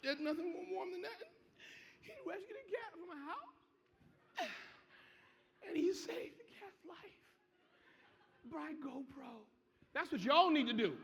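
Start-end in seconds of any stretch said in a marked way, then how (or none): 0.0-9.5 words breaking into laughter, higher and slower; laughing while talking. Real, not acted. Speaker laughing at his fireman cat story to the audience. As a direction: laugh through the story as it tickles you.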